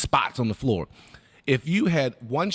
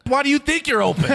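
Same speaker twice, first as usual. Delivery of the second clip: much higher, louder mocking coach; 12 semitones higher, 6 dB louder than usual. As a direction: throw the question back with scorn. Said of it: "Hugs, I'm open".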